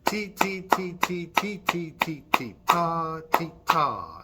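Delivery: chanted ti and ta beats; teacher demonstrating a rhythm for children; rhythm demo. Shown as a demonstration. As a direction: chant a rhythm for them to copy. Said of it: "Try that".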